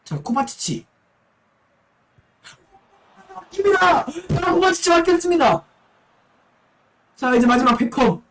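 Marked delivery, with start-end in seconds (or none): 3.3-5.6 esports caster. Acted. Penalty save call.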